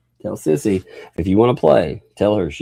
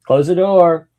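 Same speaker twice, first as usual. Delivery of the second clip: raised, higher calling out; retold call; 9 semitones higher, 7 dB louder, slower than usual. Parent retelling an order to the kids, loud high call. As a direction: call it out as if across the house.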